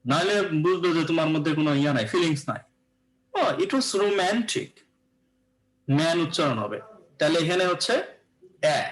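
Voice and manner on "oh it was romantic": "Oh, it was romantic" drawn out very slowly, leaning on "Oh" and "man" of "romantic"; feeling-filled stress. Shown as a demonstration. Teacher demonstrating felt stress to students, slow and stressed. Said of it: "এখানে stress দিতে হবে ওয়ার্ডগুলোতে".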